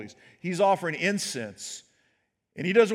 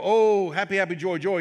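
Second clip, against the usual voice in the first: mock glee; 3 semitones higher, 6 dB louder than usual. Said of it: "he doesn't go".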